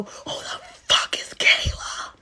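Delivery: slow, strained whisper-yell; whispered mock scream. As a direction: act out screaming under your breath. Acted confrontation of cheating boyfriend.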